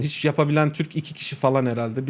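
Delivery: normal talking voice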